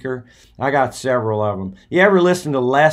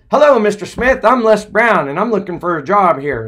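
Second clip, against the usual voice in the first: higher, louder, bright pushy greeting; eager young Les; 7 semitones higher, 5 dB louder than usual. Retold or imitated. Young Les pitching himself to the station manager, eager. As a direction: sell yourself to the boss. Les voiced, second day.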